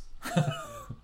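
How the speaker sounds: squeaky burst of laughter; high laugh. Real, not acted. Laughing at Trunk Monkeys name.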